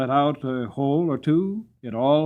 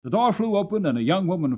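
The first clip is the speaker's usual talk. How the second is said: ordinary delivery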